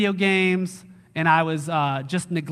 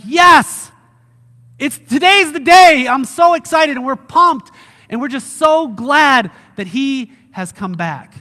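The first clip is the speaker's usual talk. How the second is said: high, loud shout of joy, gradually easing; ecstatic excitement; 7 semitones higher, 9 dB louder than usual. Acted. Believers running outside at Jesus' return, wildly excited. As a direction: shout it like the big day has arrived. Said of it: "we're just so excited for the return".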